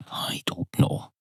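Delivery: breathy whispered shrug, slowed; a whispered I don't know; whispered aside. Real, not acted. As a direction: whisper it to yourself, half lost. Muttered aside to self.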